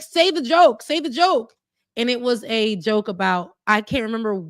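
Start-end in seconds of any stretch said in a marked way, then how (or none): 0.0-1.5 put-on pleading kid. Kid begging his dad, retold.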